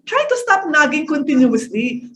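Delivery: normal talking voice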